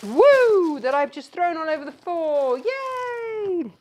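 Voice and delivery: high, loud, drawn-out mock hooray; mock cheering. Host who threw kits over the floor, mock cheering.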